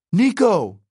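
high, urgent call by name; yell. A narrator's reading. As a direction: yell to a friend in battle. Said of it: "he yelled".

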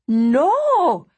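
high, loud excited no; excited cry. Acted. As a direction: burst out with excitement. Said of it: "Peik Lin cried, suddenly getting very excited".

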